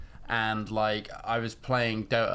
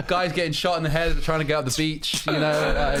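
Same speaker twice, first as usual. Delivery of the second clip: laughing; 5 semitones higher, 5 dB louder than usual. Retold or imitated. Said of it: "acting wounded soldier bit".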